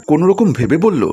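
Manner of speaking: usual speaking voice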